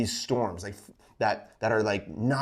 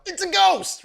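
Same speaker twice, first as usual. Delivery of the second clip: high, loud frightened shout; terrified cry; 7 dB louder than usual. A narrator's reading. Disciples in the boat crying out, high and loud in terror. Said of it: "In fear, they cried out".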